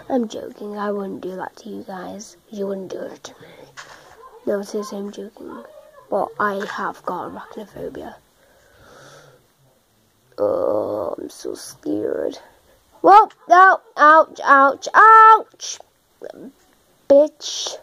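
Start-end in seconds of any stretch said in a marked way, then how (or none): 0.1-5.6 soft whispered aside; whispering. Real, not acted. Whispered joking reassurance. 10.4-12.4 low, slow mock fright; mock scared. Mock fear of spiders. 13.0-15.8 yelling in pain. Real, not acted. Taking damage in game.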